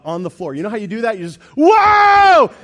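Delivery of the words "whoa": high, loud mock "whoa"; retold startled shout. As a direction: act out falling back in shock. Falling back off chair, retold.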